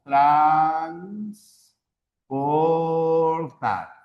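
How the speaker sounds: Transportar stretched very slowly, syllable by syllable. Shown as a demonstration. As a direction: drag out Transportar to show its syllables. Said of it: "tenemos la palabra transportar". (pronunciación muy lenta, sílaba por sílaba)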